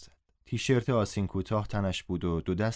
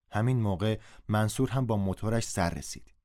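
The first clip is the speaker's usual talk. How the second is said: plain reading voice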